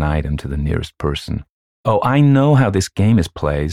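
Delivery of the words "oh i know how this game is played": put-on higher, louder child's know-it-all cry; happy cry. A narrator's reading. Child in the dark knows the game, crying happily. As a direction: pipe up happily like an excited child. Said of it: "some child cried happily in the dark".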